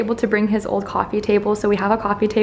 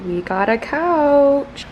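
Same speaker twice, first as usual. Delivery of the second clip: drawn-out, higher-pitched excited call; excited announcement; 5 semitones higher, much slower than usual. Real, not acted. Vlogger announcing the new couch, excited. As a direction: stretch it out in slow, rising excitement.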